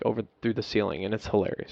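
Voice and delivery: normal talking voice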